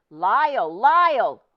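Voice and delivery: loud, high calling across a crowd. Acted. Hector calling to Lyle across the store. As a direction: call out his name over the crowd. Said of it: "the voice called out".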